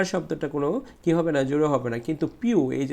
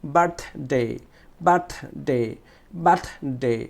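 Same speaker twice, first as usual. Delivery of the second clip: Birthday in two parts, strong "birth"; stressed first syllable; much slower than usual. Shown as a demonstration. Teacher drilling students, forceful "birth" in birthday. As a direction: punch the "birth" in birthday, then let it fall. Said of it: "বার্থটা আমরা একটু জোরে উচ্চারণ করবো".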